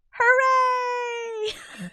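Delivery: very high, loud hammed-up hooray; mock cheer. Streamer mimics the characters' sudden joy with a squealing cheer. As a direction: throw it up like a cartoon celebration.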